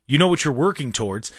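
ordinary delivery

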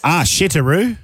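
Japanese phrase in a broad Australian accent, rising. Shown as a demonstration. Host attempting Japanese I love you in an Australian accent. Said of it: "an Australian accent".

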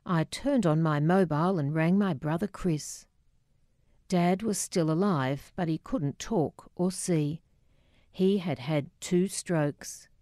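plain reading voice

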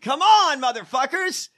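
loud, high burst of frustration; exasperated shout. Real, not acted. Mocking the failed plotters, a loud exasperated shout. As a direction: yell it out at the useless plotters. Frustrated outburst at botched plot.

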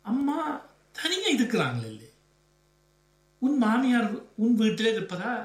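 hesitant. A narrator's reading. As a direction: hesitate between phrases, voice lifted. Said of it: "என்று தயங்கவே". (தயக்கத்துடன்)

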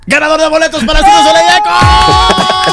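loud, high prize announcement; announcer hype. Real, not acted. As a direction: announce the winner with full hype. (anuncio de ganador emocionado y fuerte)

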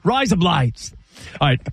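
"Rise up, lights" in an Australian accent, so "Rise up, lights" blurs toward "razor blades". Shown as a demonstration. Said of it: "in an Australian accent".

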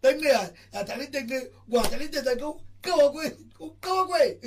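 loud high shout of "go away". Real, not acted. Speaker yells at someone to go away, loud.

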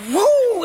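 high loud swoosh cry; whooshing whoo. Acted. Teller voicing the watch thrown high, loud high whoo.

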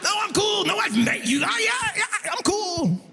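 high strained squeaks of nonchalance; nervous fake cool. On the date insisting he's cool, high nervous squeak. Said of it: "obviously I play it cool".

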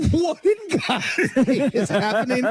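high, slow, laughing bewilderment; laughing disbelief. Retold or imitated. Retelling his shock at the clerk, high slow laugh. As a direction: laugh through the words, baffled. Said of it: "And I was like".

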